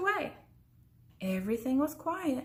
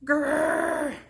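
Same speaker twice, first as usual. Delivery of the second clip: louder, higher bear growl; 5 semitones higher, 6 dB louder than usual. A narrator's reading. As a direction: growl closer and bigger.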